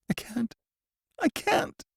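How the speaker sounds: high, soft, tearful refusal; sobbing prisoner refusing to tell. A narrator's reading. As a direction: weep out the words. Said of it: "He was sobbing".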